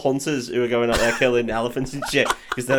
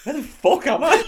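high, bewildered mock voice; a dazed victim squeaking out confusion; confused victim; 12 semitones higher than usual. Drugged patient waking up, high and bewildered.

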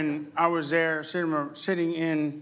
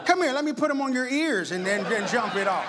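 mock threat; 6 semitones higher than usual. Joking threat continues.

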